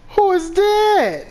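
slow, high frightened question; terrified shriek. Real, not acted. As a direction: cry out in real fear at the stranger. Figure appears again.

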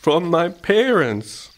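high, slow, drawn-out answer; sheep voice. A narrator's reading. Sheep answers after thinking hard, slow and considered.

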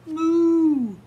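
a big, loud, high moo; hammed-up cow moo. A narrator's reading. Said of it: "said Milky".

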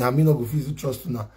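ordinary talk

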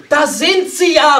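very loud, high, slow exclamation; excited alarm call; agitated cry. A narrator's reading. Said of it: "rief Fräulein Borg erregt".

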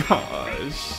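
ordinary talk

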